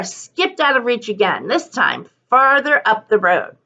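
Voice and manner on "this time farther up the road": plain reading voice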